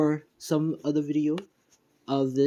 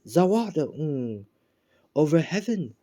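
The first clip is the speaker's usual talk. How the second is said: higher-pitched, lightly acted stand summons; summoning call; 4 semitones higher than usual. Deku summoning his stand, lightly acted battle call.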